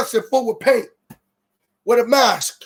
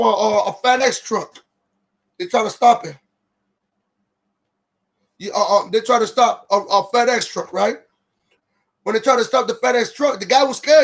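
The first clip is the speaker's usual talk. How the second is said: usual speaking voice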